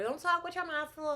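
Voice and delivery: high, scolding mock nag; nagging voice. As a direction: parrot a scolding parent. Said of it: "I don't wanna hear".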